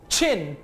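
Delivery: छिन with a strong puff of air on the ch; aspirated ch. Shown as a demonstration. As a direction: blow out the flame on the छ of छिन. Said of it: "देखा आपने". (छिन को ज़ोरदार हवा के साथ)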